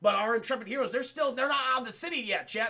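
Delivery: usual speaking voice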